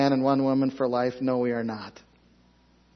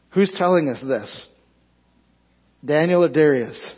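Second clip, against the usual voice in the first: ordinary talk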